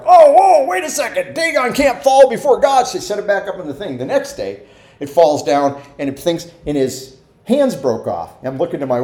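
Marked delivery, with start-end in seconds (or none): 0.1-2.8 startled, higher and louder; mock panic of the Philistines; Philistines alarmed. Philistines seeing Dagon fallen before the ark, startled and higher. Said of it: "And they're like".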